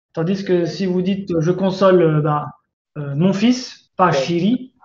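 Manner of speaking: ordinary talk, nothing marked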